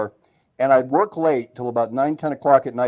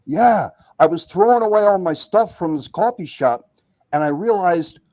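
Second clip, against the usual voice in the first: imitating an eager friend, higher pitched; excited buddy; 5 semitones higher than usual. Buddy tells how the coffee shop gave him an idea, excited. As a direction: act out your buddy explaining his big idea. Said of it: "He says".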